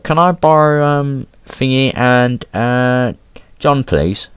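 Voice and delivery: high, hesitant imitation of the head of year; mock high teacher voice. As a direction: squeak it out like an awkward teacher.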